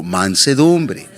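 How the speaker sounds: mansedumbre drawn out slowly, syllable by syllable; slow call to repeat. Shown as a demonstration. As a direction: stretch out mansedumbre for the congregation to repeat. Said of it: "Diga conmigo".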